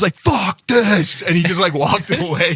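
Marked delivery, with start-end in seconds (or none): 0.1-1.2 mock angry. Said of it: "He was so fucking mad".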